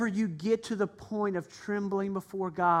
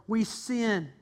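ordinary talk

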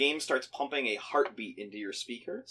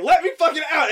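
high, loud, exasperated demand; retold yelling; 13 semitones higher, 8 dB louder than usual. Trapped player yelling at the one blocking him, retold yell. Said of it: "I started yelling into my microphone".

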